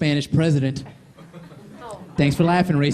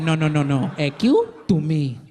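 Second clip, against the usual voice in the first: a hint of a Spanish-accented mother, slow; mother's Spanish accent; 3 semitones lower, slower than usual. Mother yelling at her son in broken English, lightly accented. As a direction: lightly voice a scolding immigrant mom. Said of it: "She'd be like".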